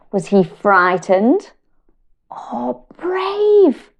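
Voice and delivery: slow, sing-song picture book reading; reading to children. Acted. Mum reading a feelings book to her children, slow and sing-song. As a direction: draw little listeners into the story.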